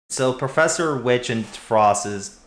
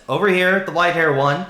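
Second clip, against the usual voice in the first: loud, raised call across a space; high, urgent holler; 8 semitones higher, 7 dB louder than usual. Acted. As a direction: shout it over to get their attention. Professor calling across the scene.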